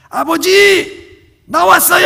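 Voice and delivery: very high, very loud, drawn-out shout; shouting in his ear. Acted. Son shouts into his father's ear, very loud and high. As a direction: bellow it right into his ear. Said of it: "귀에다 대고 큰 소리로".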